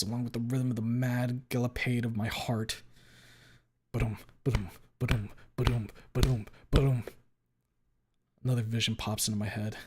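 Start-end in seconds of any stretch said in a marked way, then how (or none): 3.9-7.0 rhythmic ba-dum heartbeat, rising at the end; heartbeat sound. Acted. Narrator feeling his heart gallop, rhythmic heartbeat. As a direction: pound it out like a racing heart.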